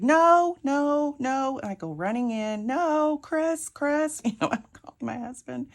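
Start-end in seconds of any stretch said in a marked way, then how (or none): 0.0-1.6 high, loud panicked yelling. Retold or imitated. Fleeing from the snake, high loud panicked cries. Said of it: "I'm like". 2.7-4.2 high panicked call for help. Retold or imitated. Calling her husband Chris about the snake, high panicked call.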